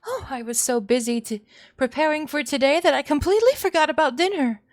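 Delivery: high, loud, overexcited hostess voice; a maniacal mother loudly proposing a midnight feast; manic gushing mother. Acted. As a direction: gush like a deranged housewife.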